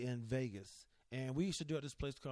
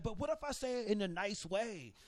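higher, innocent asking voice; naive French guy; 6 semitones higher than usual. Retold or imitated. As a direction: play the clueless tourist asking sweetly. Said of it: "he's like".

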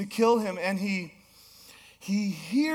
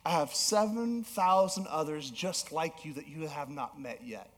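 gentle God reassurance; 5 semitones lower, 6 dB quieter than usual. Acted.

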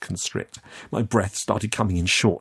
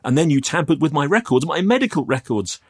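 high, quick, louder charge; heated, rising indignation; accusing; 8 semitones higher, 6 dB louder than usual. Acted. Youth accuses man of tampering, heated.

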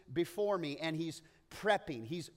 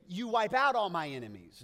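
mock triumphant; 3 semitones higher, 9 dB louder than usual. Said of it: "we would want to say".

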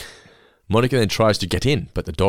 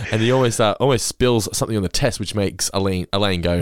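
usual speaking voice

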